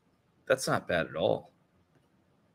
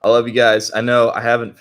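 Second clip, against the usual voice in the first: loud excited; 3 semitones higher, 12 dB louder than usual. Real, not acted.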